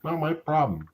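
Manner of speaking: high-pitched dismissive defiance; mock-indignant high voice; defiant. Real, not acted. Defiant about offending viewers.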